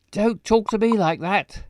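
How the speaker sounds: angry character line. Acted. Reads angry game line.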